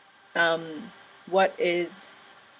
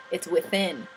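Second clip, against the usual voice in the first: ordinary talk, nothing marked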